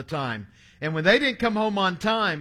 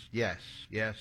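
low, soft, resigned-sounding answer; subdued reply; 8 semitones lower, 9 dB quieter than usual. Retold or imitated. Ailing grandfather answering his worried grandson, subdued.

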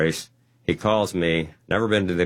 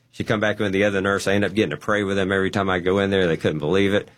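ordinary talk

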